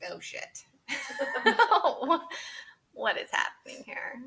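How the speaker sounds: stunned laugh breaking through the words; laughing shock. Retold or imitated. Reliving the test result with a shocked laugh. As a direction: laugh in disbelief at the news.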